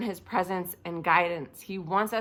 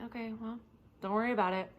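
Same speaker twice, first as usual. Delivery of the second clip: offhand and softer, unbothered; very casual; 7 dB quieter, faster than usual. Acted. Elisha sipping coffee, reassuring his servant, very casual. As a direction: toss it off quietly between sips of coffee. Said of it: "very casually and saying".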